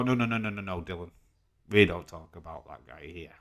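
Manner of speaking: usual speaking voice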